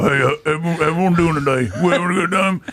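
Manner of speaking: lightly voiced gritty old auctioneer; mild gravelly old-timer greeting the crowd; grizzled auctioneer voice. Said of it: "a grizzled, uh, retired auctioneer".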